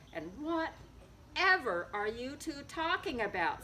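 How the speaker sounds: ordinary delivery, nothing marked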